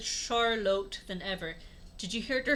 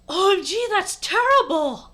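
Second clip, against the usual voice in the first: gasped; 10 semitones higher, 9 dB louder than usual. Acted. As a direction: exclaim it in hammed-up horror. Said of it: "I gasped".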